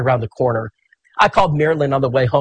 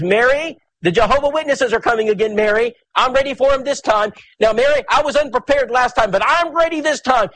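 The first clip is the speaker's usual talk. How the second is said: eager excitement; 7 semitones higher than usual. Retold or imitated. Charles eager for the Witnesses.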